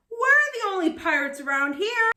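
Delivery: high, loud scornful boast; scoffing. Acted. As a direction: scoff it off proudly. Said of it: "scoffed Firebeard".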